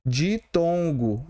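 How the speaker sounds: ditongo drawn out slowly with a rising end; teacher stretching ditongo slowly, rising; slow syllable demo. Shown as a demonstration. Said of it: "Aqui é um".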